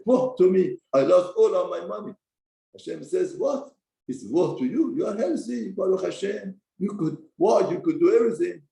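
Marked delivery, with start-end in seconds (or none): 0.0-2.1 higher, louder mock wail of woe; a hammed-up cry of woe; lament. Rich chassid laments his loss. 3.4-8.6 higher chiding divine voice; lightly voiced God scolding; God rebuking. Hashem rebukes the chassid.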